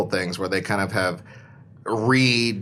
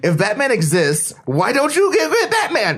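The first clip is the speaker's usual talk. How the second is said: incredulous; 3 dB louder than usual. Real, not acted. Incredulous rant about Batman.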